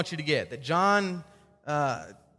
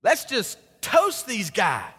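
mock eager zeal; 5 semitones higher, much slower than usual. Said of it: "He's saying".

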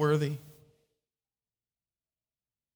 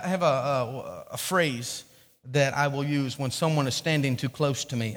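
ordinary talk

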